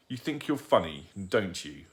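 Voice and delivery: ordinary delivery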